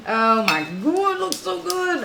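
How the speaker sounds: excited. Real, not acted. Excited over the steak.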